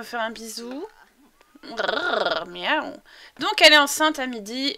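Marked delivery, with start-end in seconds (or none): 1.6-2.9 rolled purr into a meow; cat noises. Pretend. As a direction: imitate a trilling cat.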